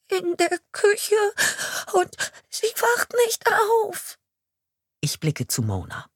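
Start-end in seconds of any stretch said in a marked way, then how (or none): 0.1-4.2 put-on high, slow, whimpered child's voice fading away; whimpering child. A shaking girl whimpering to the police chief where her grandmother lies. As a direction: whimper it out, barely holding on. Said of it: "Wimmernd hebt sie eine zitternde Hand".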